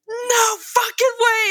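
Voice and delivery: high, drawn-out retold shout; imitated furious yell; raging bandmate. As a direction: explode in mock outrage. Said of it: "like, raging at him".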